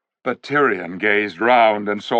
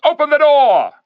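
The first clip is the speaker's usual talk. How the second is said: much higher, louder urgent shout; 8 dB louder than usual. A narrator's reading. King shouting to Poggin to open, loud. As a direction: bark it at the door.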